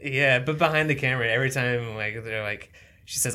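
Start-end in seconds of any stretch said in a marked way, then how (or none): none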